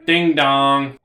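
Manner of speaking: ding dong sung out loud like a doorbell chime; imitating a doorbell. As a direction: make a doorbell sound with your voice. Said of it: "I go".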